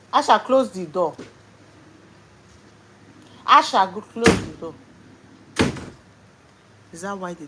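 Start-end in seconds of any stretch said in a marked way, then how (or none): 0.1-1.2 loud call across the house. Real, not acted. Mother calling Asha to close the door, loud call. 3.5-4.7 high, loud insistent call; louder call. Real, not acted. Mother calling Asha a third time, loud insistent call.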